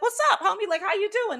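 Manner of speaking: a cousin greeted with laughing joy; delighted greeting. Retold or imitated. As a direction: greet him with giddy surprise. Greeting long-lost cousin in dream.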